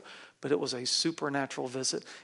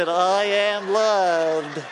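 loud, long-drawn excited cheer; 3 semitones higher, 8 dB louder, much slower than usual. Shown as a demonstration. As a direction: stretch every word out loudly with excitement. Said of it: "say it like you're excited about it".

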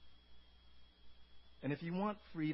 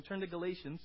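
normal talking voice

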